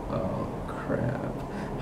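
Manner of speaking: hushed, voiceless "uh-oh" reaction; very soft, breath-only whisper; dismayed whisper. Acted. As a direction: whisper it under the breath in dread. Players see leader waiting, dismayed.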